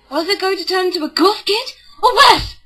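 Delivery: high, loud rising shriek of mock dread; mock horror. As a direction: build to a horrified squeal on emo. Mock horror at becoming emo.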